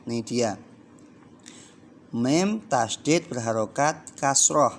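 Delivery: ordinary talk, nothing marked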